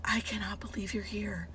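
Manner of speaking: whispered, overcome disbelief; welling up. Retold or imitated. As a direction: whisper it like a patient moved to tears. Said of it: "like, welling up".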